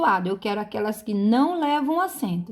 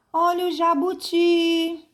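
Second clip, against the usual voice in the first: jabuti called high, final TI held; street vendor's call; 7 semitones higher than usual. Shown as a demonstration. Teacher trying the selling trick on jabuti, vendor's call. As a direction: hawk the word with a long, level ending. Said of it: "é você vender a palavra".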